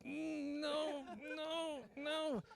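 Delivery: high strained whimpering no; high pained whine, holding it in; straining groan. Retold or imitated. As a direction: squeeze the words out in pain. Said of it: "I'm like".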